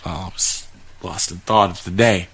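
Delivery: usual speaking voice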